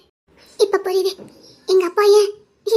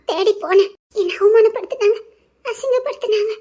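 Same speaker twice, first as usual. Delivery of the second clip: crying; 2 semitones higher than usual. Acted. Telling of being humiliated, breaking down.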